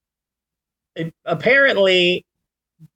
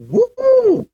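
excited whoop; 4 dB louder than usual. Real, not acted. Admiring own look.

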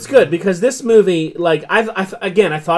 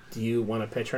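quiet quote; 8 semitones lower, 13 dB quieter than usual. Retold or imitated. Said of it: "was like".

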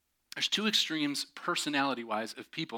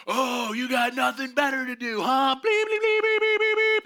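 shouted very high, loud and angry; mock yelling; 12 semitones higher, 8 dB louder than usual. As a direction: screech the bleeped insults. Contractor yelling at code enforcer.

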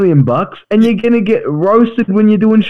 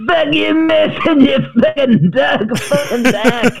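mock fan yell; 6 semitones higher, slower than usual. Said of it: "this guy's just yelling at him".

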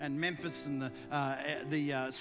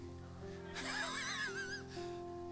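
burst of laughter; 11 dB quieter than usual. Real, not acted. Laughs at own joke.

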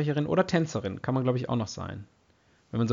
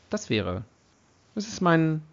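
normal talking voice

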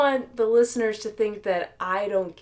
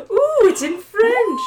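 excited reveal; 14 semitones higher, 8 dB louder than usual. Real, not acted. Showing off a surprise gift.

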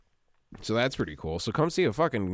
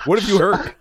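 laughing mock suspicion; 7 semitones higher, 8 dB louder than usual. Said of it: "joking conspiratorial reply".

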